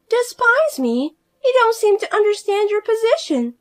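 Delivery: high, loud, self-important outrage; indignant sister-in-law. Acted. Fiona demanding her sister-in-law respect her, high and indignant. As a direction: demand respect she hasn't earned.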